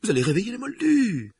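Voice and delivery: put-on high, tense older woman; McGonagall voice. A narrator's reading.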